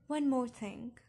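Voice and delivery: ordinary delivery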